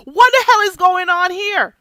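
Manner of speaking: incredulous yell. Retold or imitated. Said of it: "was like".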